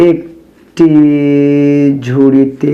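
words drawn out slowly, syllable by syllable; slow write-along delivery; dictating while writing. Real, not acted. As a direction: stretch each word as you write it. Writing the sum while saying it.

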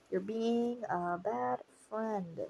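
very slow, drawn-out telling-off; long-drawn mock disappointment; mock scolding. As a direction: stretch every word like a teasing reproach. Scolding the character in reaction.

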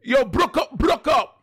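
high, hammed-up Jamaican accent; playful attempt at a Jamaican accent; mock Jamaican accent. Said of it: "terrible Jamaican accents".

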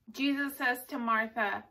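ordinary talk, nothing marked

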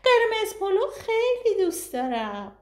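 put-on high, whiny little girl; whining child. A narrator's reading. Little girl whining that she loves the red rice, high and whiny. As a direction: whine it like a sulky kid. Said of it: "صوفی نق زد".

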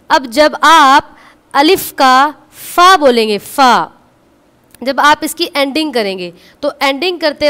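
explaining loudly to the class; loud teaching voice. Real, not acted. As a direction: project to the back of the room. (ऊँची आवाज़ में समझाना)

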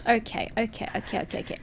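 whispered okays to calm down; breathless whisper. Real, not acted. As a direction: talk yourself down in a whisper. Calming self after scare.

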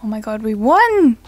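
loud burst of joy at winning; thrilled cheer. Real, not acted. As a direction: shout the win out in delight. Winning the game's contest.

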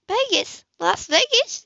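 high, incredulous repeat; can't believe what she hears; shocked high disbelief. Acted. Heroine shocked at plan.

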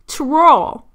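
"twirl" forced out high and loud as "troll"; mispronounced twirl. Real, not acted. Podcaster failing to say the word, "twirl" mangled toward "troll". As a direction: try "twirl" hard, losing the w after the t. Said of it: "I cannot say it".

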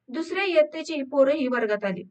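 ordinary delivery

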